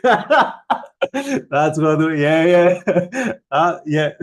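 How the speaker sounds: chuckling through short yeahs; laughing. Real, not acted. To a fellow Greek viewer, amused laughing. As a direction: laugh along at the shared joke.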